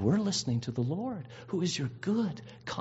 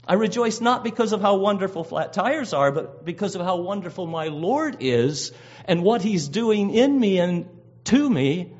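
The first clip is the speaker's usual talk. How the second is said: loud, impassioned sermon voice; rising preacher's fervor; fervent preaching; 8 dB louder than usual. Acted. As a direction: preach it out with full conviction. Preaching at full force.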